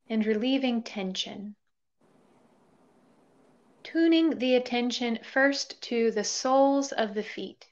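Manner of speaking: calm body-scan voice with long pauses; guided meditation. Real, not acted. Yoga teacher leading listeners through a body scan, guided meditation. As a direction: guide them gently into stillness, leaving space between phrases.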